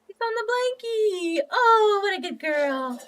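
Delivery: high, squealing joy as the cat settles; delighted squeal. Real, not acted. Cat sits on blanket.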